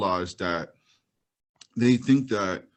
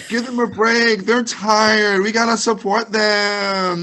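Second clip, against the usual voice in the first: much higher, louder whiny plea; mock pleading defender; 10 semitones higher, 6 dB louder, much slower than usual. Co-host mocking a girl defending politicians, whiny and high. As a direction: whine like an overwrought fan. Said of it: "the girl on the steps".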